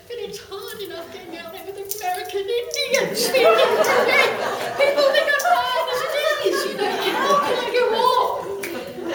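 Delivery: high, loud, laughing protest; exasperated laughing rant; laughing exasperation. Retold or imitated. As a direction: laugh through the complaint. Exasperated, laughing at own predicament.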